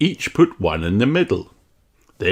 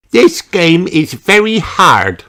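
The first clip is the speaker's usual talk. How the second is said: higher, louder, slow smug warning; 9 semitones higher, 8 dB louder than usual. Acted. Peppa warns Susie the game is hard, smug. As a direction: warn her with a know-it-all tone. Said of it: "warns Peppa".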